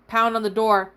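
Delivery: plain reading voice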